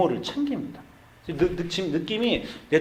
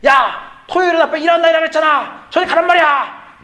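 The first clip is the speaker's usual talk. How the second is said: much higher, louder, fast furious yell; big yell; 9 dB louder than usual. Retold or imitated. Exhausted pastor screaming at his son on Saturday. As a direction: explode at the boy to get away. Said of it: "소리를 대빵 질렀어요".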